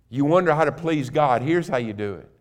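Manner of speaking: usual speaking voice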